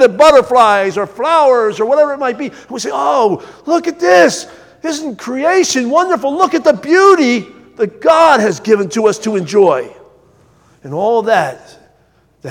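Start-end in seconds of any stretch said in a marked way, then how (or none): 2.9-9.9 awed wonder. Acted. Preacher voicing churchgoers marvelling at creation, awed and rising to a shout. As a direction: let it swell into a joyful call of wonder.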